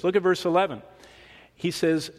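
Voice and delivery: ordinary talk, nothing marked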